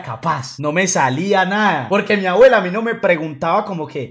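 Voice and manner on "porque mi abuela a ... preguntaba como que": ordinary talk, nothing marked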